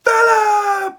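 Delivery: loud, high answering yell; shouted back. Retold or imitated. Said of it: "And they're like".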